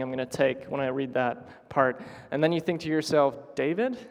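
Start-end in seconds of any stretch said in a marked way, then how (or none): 3.5-4.0 doubting question, pitched up; incredulous. Acted. Listener thinking to himself about David's claim, incredulous. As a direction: question the name in disbelief. Acting out a doubting inner thought.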